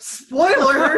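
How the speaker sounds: a playful warning cry, laughing; excited spoiler call. Real, not acted. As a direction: yell it out between laughs. Jokes about a game death spoiler.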